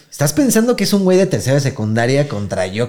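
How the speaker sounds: ordinary delivery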